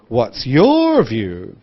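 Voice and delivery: slowly stretched "your", swooping down on "view"; wide intonation. Shown as a demonstration. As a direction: lift "your", then let "view" fall. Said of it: "think about connected speech and intonation".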